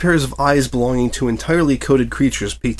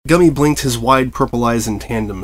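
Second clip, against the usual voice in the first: usual speaking voice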